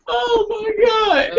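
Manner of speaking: high stretched out shock; shocked shout. Real, not acted. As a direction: cry out in amazed disbelief. Shocked reaction to hand color.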